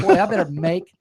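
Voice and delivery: ordinary talk, nothing marked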